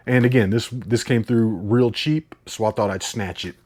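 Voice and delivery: ordinary talk, nothing marked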